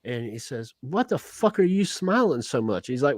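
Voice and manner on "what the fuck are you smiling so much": higher-pitched, lightly Southern devil; Southern accent. Acted. Baffled devil questioning grinning Tex, light Southern accent. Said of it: "has a Southern accent".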